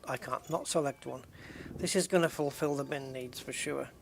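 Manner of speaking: normal talking voice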